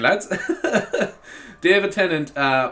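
ordinary delivery

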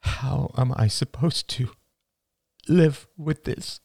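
high, pleading mother's voice; slow, anguished question; distressed mother. Acted. Mother asking her son in despair, high and pleading.

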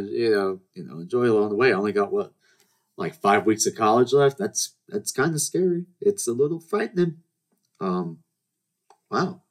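normal talking voice

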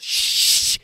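loud, long hissed shush; forceful shush. Retold or imitated. Mother hushing girl who wants home, sharp shush. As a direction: shush a noisy child hard.

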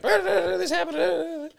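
high, slow wailing mock cry; mocking a customer's shrill complaint to the owner; mock whiny complaint. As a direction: whine it like a hammed-up crybaby. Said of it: "So she lights me up saying".

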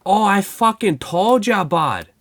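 high, loud, slow hammed-up Canadian gloat; crowing like a smug Canadian fan; mock Canadian accent. Mocking the dead-to-me fan with Canadian gloating. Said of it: "that was more Canadian".

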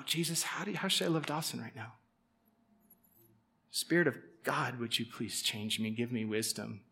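soft, hushed prayer to Jesus; quiet prayer. Retold or imitated. Worker praying silently about a cussing coworker, soft and hushed. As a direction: pray it under your breath.

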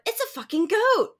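imitating her own excited exclamation; blurting out. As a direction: blurt it at the goat out the car window. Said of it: "I pointed, and I went".

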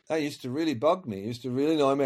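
normal talking voice

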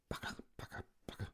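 whispered sputtering pen noise; breathy pucker pucker of a dying pen; imitated pen sputter.

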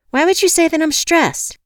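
high, loud defensive bark; indignant bark. A narrator's reading. Caller bristles at being called stressed, barking. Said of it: "I barked".

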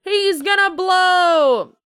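excited shout. Real, not acted. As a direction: yell it, gleefully horrified. Shouting at gory scene.

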